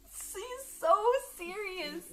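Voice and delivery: normal talking voice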